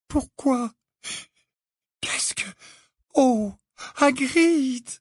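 high, broken, halting dismay; near tears. A narrator's reading. Hermione despairs at Hagrid's folly, near tears. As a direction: break off, then wail the name slowly. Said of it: "qui paraissait au bord des larmes".

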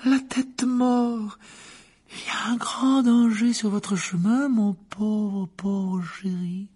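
high, slow, theatrical seer with a tragic tremble; a dramatic fortune-teller moaning over the cup; Trelawney voice. Acted. The divination teacher warns Harry, high and theatrical.

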